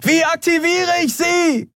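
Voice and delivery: very high, drawn-out screamed question; slow, high yell over engine noise; desperate scream. A narrator's reading. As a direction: scream as if tearing your vocal cords. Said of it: "schrie Andriel, dass es ihr fast die Stimmbänder zerriss".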